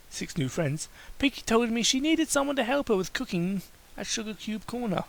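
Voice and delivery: usual speaking voice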